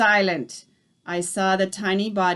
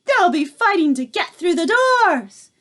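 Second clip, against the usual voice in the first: higher, louder cackling chorus; gleeful witches; 9 semitones higher, 7 dB louder than usual. A narrator's reading. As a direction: crow with wicked delight.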